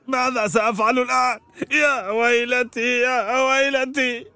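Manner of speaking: slow, wailing lament. Acted. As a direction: moan slowly over your bad luck. Potter laments his fate.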